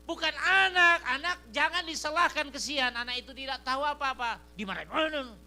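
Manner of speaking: loud, high preaching voice. Acted. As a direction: preach it out to the church. Preacher at full force.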